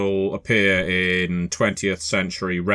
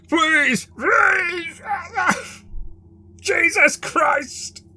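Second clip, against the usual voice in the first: terrified begging; much slower than usual. Acted. Fake Jesus on his knees begging his attacker, shrieking. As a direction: beg for mercy through a cry.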